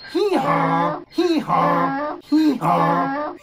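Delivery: loud, two-pitched hee-haw; donkey bray. Pretend. Making the donkey's sound with children, braying. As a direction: bray like a donkey, high then dropping. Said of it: "Make the sound with me".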